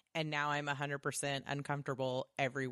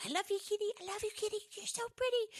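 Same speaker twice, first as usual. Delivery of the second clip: put-on much higher gushing baby voice; baby talk to a cat; much faster than usual. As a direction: dote on the kitty like a baby. Fury doting on the cat.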